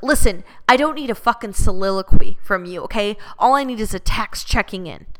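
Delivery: loud, hard-edged mock rant as the ex; retold angry yell. Controlling ex yelling at her while she travels, angry. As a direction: bark it like a fed-up boyfriend.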